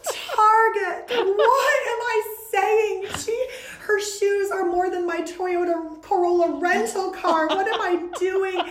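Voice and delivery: high, loud comic horror at herself; mortified disbelief. Retold or imitated. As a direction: shriek in mock horror at your own blunder. Inner horror at her own blunder.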